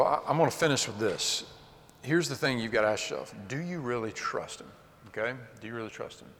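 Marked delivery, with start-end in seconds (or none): none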